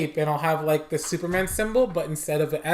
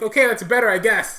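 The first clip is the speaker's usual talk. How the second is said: grudging customer; 5 semitones higher, 5 dB louder than usual. Acted. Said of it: "And he's like".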